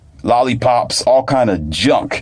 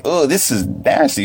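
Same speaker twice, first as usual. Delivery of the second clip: higher-pitched mock "yuck" reaction; imitated disgust; 5 semitones higher than usual. Child tasting raw mushroom first time, imitated disgust. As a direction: mimic a child grossed out by a taste. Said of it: "of course her face scowled".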